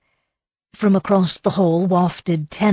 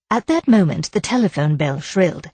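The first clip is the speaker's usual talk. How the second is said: usual speaking voice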